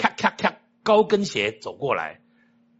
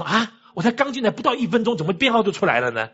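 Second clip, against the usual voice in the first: 惊讶; 6 semitones higher than usual. Retold or imitated. Retold startled thought at quick number.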